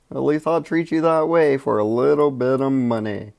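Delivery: high, loud put-on pitchman voice; mock huckster. Hawking a five dollar trifold to cops, mock huckster. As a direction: sell it like a hammed-up preacher after money.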